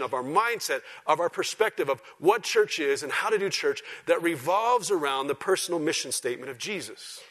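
loud, high, driving sermon delivery; full-force preaching. Real, not acted. Preacher challenging his church's mindset, preaching at full force.

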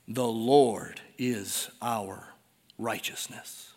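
slow, hushed, every word weighted; slow solemn close. Real, not acted. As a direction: let the verse settle slowly into a whisper. Scripture climax, slowed.

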